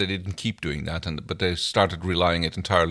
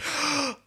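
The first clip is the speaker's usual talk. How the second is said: sharp gasp; 4 dB quieter than usual. Real, not acted. Sudden idea of ukulele.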